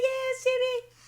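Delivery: very high retold squeal; excited confirmation. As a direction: squeal the answer back, playfully hammed up. Retells excited answer to mother.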